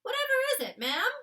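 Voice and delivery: a maid greeting the Queen politely; oldish maid. Acted. The maid greets the Queen in the morning, oldish respectful voice. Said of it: "the voice of an oldish person".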